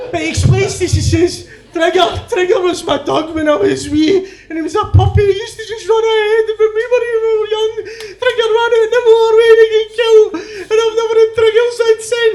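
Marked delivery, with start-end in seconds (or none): 1.7-12.3 very high, sobbing explanation; hammed-up grief, pitched high; tearful wail. Stracky explains losing his childhood dog, crying wail. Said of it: "Stracky's in tears".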